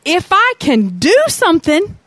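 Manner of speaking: Jesus echoing the father's words in disbelief; incredulous echo. Retold or imitated. Jesus echoing the doubting father, incredulous. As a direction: repeat it back, incredulous. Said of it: "You know what Jesus' response is?".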